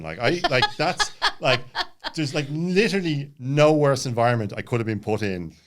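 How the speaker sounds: giggling at how absurd it was; laughing. Real, not acted. ADHD guest laughing about his silent retreat.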